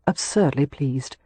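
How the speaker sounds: ordinary delivery, nothing marked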